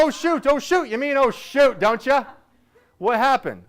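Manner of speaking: sing-song fix of a bad word; correcting dad. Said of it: "I go".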